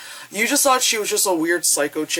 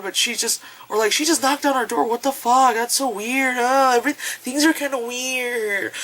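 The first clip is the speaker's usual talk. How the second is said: lightly higher bewildered chatter; mock tourist; 7 semitones higher than usual. Couple filming their road trip, lightly mocked bewilderment. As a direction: play a clueless weirded-out tourist. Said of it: "it'll be like".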